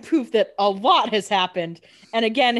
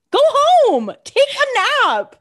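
much higher, louder, drawn-out scolding; exasperated yell; 8 dB louder, slower than usual. Real, not acted. Telling Vicky to leave the grill, a loud exasperated yell. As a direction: yell it like a fed-up big sister.

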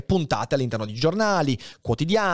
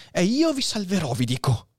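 exclaiming; 7 semitones higher than usual. Acted. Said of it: "esclamò Dantes".

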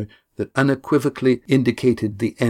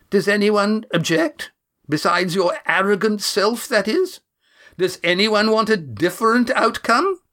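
higher, scornful woman's voice; sharp older woman; 11 semitones higher than usual. Acted. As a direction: challenge the room with scorn. Janice voiced higher than narration.

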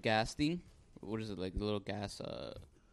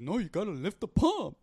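mock gas worker; 9 semitones higher than usual. Worker voiced in retelling.

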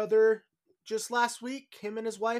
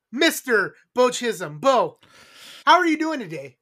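announcer introduction; 3 semitones higher, 9 dB louder than usual. Acted. Host presents his co-host to listeners, loud announcer voice. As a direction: boom out the name like a ring announcer.

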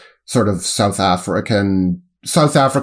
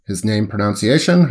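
ordinary talk

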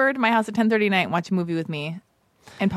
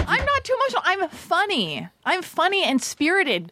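indignant insisting; 8 semitones higher than usual. Real, not acted. Insists on being funny.

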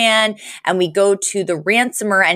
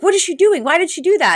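much higher, mock bewildered outburst; mock baffled kidnapper; 10 semitones higher, faster than usual. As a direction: play the confused kidnapper watching from the van. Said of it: "the guy is like".

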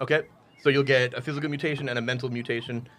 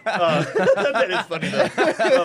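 laughing while talking; 5 semitones higher, 5 dB louder, slower than usual. Real, not acted. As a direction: get the words out through laughter. Laughing at the joke.

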